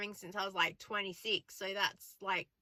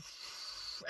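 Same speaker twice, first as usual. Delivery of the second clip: sigh; 12 dB quieter than usual. Shown as a demonstration. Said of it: "of course I just went".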